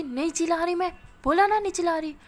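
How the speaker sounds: loud, high angry denial; shouting. A narrator's reading. Anu denying to Ana that she is yelling, shouting. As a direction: yell it back in protest. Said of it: "अब तुम मुझ पे चिल्लाओगी".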